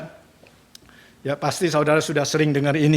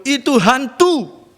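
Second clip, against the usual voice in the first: high, slow cry of fear at a ghost; frightened cry; 8 semitones higher, 3 dB louder, slower than usual. Retold or imitated. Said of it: "mereka berseru". (teriakan ketakutan melihat hantu)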